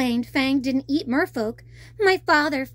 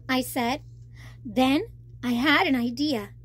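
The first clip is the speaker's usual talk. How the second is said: ordinary delivery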